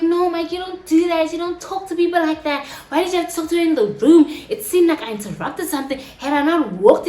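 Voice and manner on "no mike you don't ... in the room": raised-pitch, insistent reproach; upset scolding. Acted. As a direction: scold him in a hurt, higher voice. Retold scolding of Mike.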